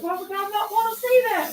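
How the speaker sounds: very high-pitched imitation; high mock voice. Quoting the show to a friend, high mock voice. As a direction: put on a high, exaggerated voice. Retelling a character's indignant reply.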